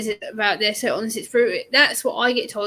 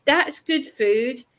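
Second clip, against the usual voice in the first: quoted guy; 3 semitones higher, much slower than usual. Retold or imitated. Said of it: "He goes".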